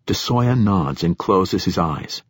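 plain reading voice

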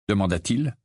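plain reading voice

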